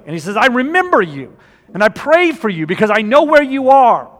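fired-up sermon delivery; preaching at full force. Acted. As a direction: preach it out to the congregation.

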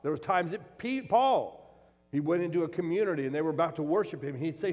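loud, high-pitched preaching; fervent pulpit preaching; preaching at full force. Acted. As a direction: drive the point home to the congregation. Preacher rising into forceful delivery.

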